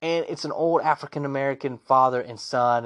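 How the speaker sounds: ordinary talk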